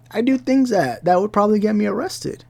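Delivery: worked up, voice rising high; heated outburst, pitched high. Real, not acted. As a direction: pitch climbing high as the anger builds. Heated rant about violent revenge.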